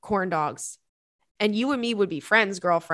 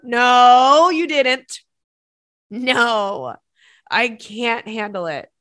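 loud, drawn-out cry of horrified disbelief; shocked disbelief; 9 dB louder, much slower than usual. Real, not acted. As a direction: wail the "no" in real shock. Shocked reaction to the letter's detail.

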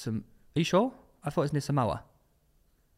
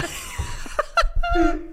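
drawn out, much higher, and dissolving into laughter; laughing; 3 dB quieter, much slower than usual. Real, not acted. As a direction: stretch the word very slowly while cracking up. Mocking Pulisic's name.

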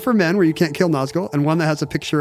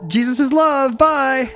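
chirpy heavenly voice; 9 semitones higher, slower than usual. Pretend. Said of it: "joining heaven ghost bit".

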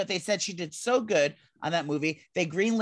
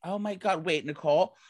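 normal talking voice

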